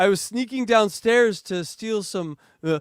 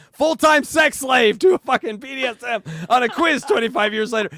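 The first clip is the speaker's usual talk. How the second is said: mock shout; 6 semitones higher, 6 dB louder than usual. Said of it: "you're answering".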